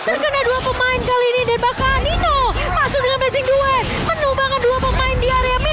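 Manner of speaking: high, fast hyped teamfight call; fighting game announcer. Acted. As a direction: shout the action like a caster.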